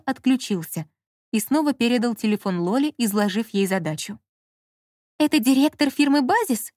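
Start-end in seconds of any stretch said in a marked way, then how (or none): 5.2-6.7 higher, mildly agitated woman's voice. A narrator's reading. Lola phoning the firm's director, agitated. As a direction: ask with a touch of agitation. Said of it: "проговорила она взволнованным голосом".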